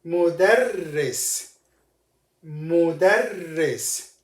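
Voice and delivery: Mudarris said slowly, the rr doubled and held; slow and careful. Shown as a demonstration. Teacher modelling the word slowly for students. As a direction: draw Mudarris out syllable by syllable. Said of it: "One more time".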